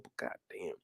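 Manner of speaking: fed-up captain grumbling to himself; muttered curse. Acted.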